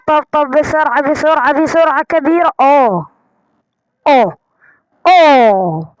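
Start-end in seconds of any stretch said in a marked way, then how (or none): 2.6-5.9 loud amazed oh; surprised oh. Real, not acted. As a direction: gasp out in wonder. Surprised at treasure.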